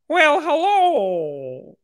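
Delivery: high, very slow drawn-out Peterson voice; mock Jordan Peterson greeting; Jordan Peterson impression. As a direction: stretch out a creaky, high professor's greeting. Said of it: "Let's see the voice".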